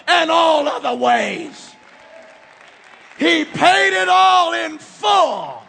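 ringing pulpit declaration; preaching at full force. Acted. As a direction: drive it home like a revival preacher. Climactic altar-call declaration.

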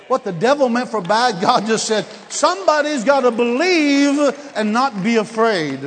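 loud, high exhortation, stretched out at the end; rally the congregation with a big proclamation; full-force preaching. Real, not acted.

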